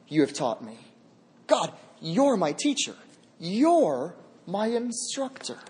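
ordinary talk, nothing marked

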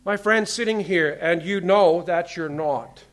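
loud, high, driving preacher's voice; impassioned pulpit delivery, slowed for weight; full-force preaching. Acted. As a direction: preach it hard to the whole room.

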